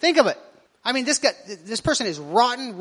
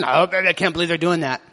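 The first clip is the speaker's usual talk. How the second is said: lower, disapproving churchgoer voice; mock grumbler; 6 semitones lower than usual. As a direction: play a scandalised gossip in the pews. Pastor imitates grumbling churchgoer.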